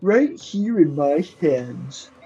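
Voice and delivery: haughty, pompous buffoon; bumbling fool. Acted. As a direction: sneer it like a self-important fool. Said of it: "haughty buffoon voice".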